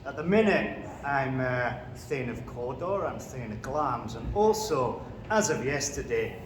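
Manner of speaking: put-on Macbeth in a Scottish accent; broad Scottish accent. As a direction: speak as a Scottish warrior king, Scottish accent. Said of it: "Do you want a quick example?".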